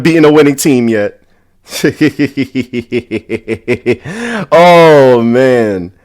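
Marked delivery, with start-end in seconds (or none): 1.7-4.5 low, quick, wheezy hee-hee run; long snickering chuckle rising at the end; gloating laugh. Real, not acted. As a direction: snicker on and on with glee. Gloating laugh at rival team.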